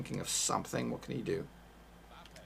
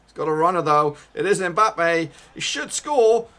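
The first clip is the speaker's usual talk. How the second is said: loud rising commentary yell; building excited roar; excited shout; 6 semitones higher, 14 dB louder than usual. Real, not acted. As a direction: shout it as the striker breaks through. Live goal chance rising.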